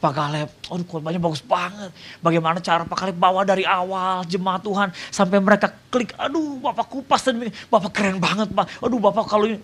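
high-pitched mock gushing fan; gushing praise. Congregants gushing to the visiting pastor, excited high-pitched praise. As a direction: imitate admirers heaping on excited compliments.

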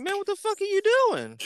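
very high fast disbelief; high shocked squeal. Retold or imitated. High squeal watching his friend climb the tree. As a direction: shriek it out in disbelief.

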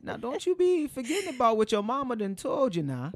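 put-on high, loud old Louisiana grandma voice; a doting grandmother from New Orleans; Grandma Agnes. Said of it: "Now, Grandma Agnes".

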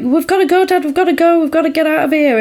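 high-pitched, urgent escape plea; mock-panicked urging, pitched high. Panicked boy urging dad to flee.

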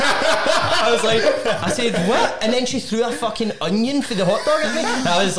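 high, helpless laughter while telling; can barely get the words out for laughing; laughing through it. Real, not acted. Retelling onion thrown, cracking up.

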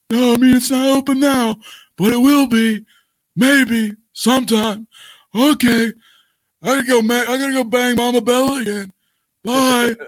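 high, polite mock-corporate voice; professional guy. Executive politely firing a wrestler over the phone, smarmy. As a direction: fire her sweetly like a smarmy executive. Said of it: "Johnny Ace being the professional guy".

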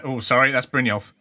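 usual speaking voice

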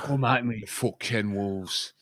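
echoing the tough Liam Neeson taunt, slowed; lightly gruff action-hero dare; mild tough-guy taunt at the wolves; Liam Neeson impression. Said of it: "Liam Neeson himself is here".

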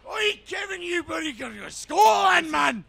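high, loud fake Scottish accent, shouted slowly; mock Scottish shout. Mocking a friend's Scottish line, hammy Scottish shout. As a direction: belt it out in a hammy Scottish brogue.

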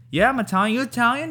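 high, excited Italian-American greeting. Retold or imitated. Retelling a TikTok of Italians meeting, high Italian-American voice. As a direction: imitate an Italian meeting another Italian. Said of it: "Italians running into each other".

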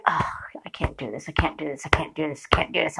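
hushed, rapid repeated whisper to oneself; whispered self-talk. Retold or imitated. Employee resisting temptation on the clock, whispered self-talk. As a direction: whisper it fast under your breath, re-enacted.

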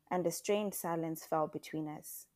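ordinary delivery, nothing marked

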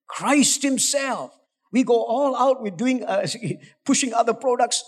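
usual speaking voice

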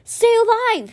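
high, loud pleading cry; desperate cry. A narrator's reading. Boy begs the dying whale, desperate cry. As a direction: beg her to live. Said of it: "he cried".

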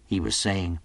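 ordinary delivery

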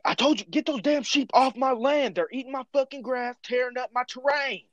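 high, strained mock yell of an angry cowboy. Cowboy shouting at his neighbour over sheep, high mock yell. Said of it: "they start yelling at each other".

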